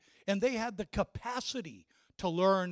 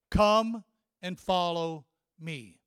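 slow, deliberate summons, word by word; spaced-out, measured invitation; rabbi's solemn call; 6 dB louder, slower than usual. Acted. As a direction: call the chosen one with weighty pauses. Acts rabbi calling chosen boys.

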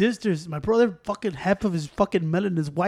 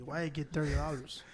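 ordinary talk, nothing marked

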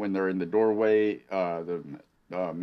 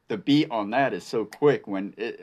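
usual speaking voice

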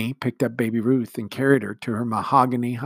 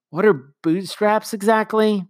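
loud whisper; 11 semitones higher, 7 dB louder, slower than usual. A narrator's reading. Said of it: "whispered Jane loudly".

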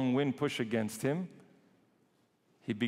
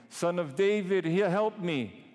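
pleading blind man; 6 semitones higher, 5 dB louder than usual. Acted. Blind man begging Christ.

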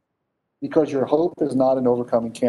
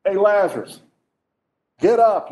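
casual raised call to a sleeper; higher, louder mock summons; 6 semitones higher, 4 dB louder than usual. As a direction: holler a wake-up call. Voicing Jesus calling dead Lazarus.

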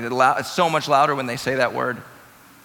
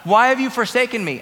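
high, loud anguished cry to God; 6 semitones higher, 7 dB louder than usual. Retold or imitated. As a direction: cry it out as a dying man's plea. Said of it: "He cried out".

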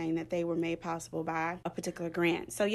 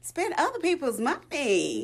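higher, gleeful sing-out; playful relish; 7 semitones higher, 3 dB louder than usual. Real, not acted. Librarian boasting of buying books with others' cash, playful relish. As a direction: relish it like a cheeky perk. Gleeful joke about job perk.

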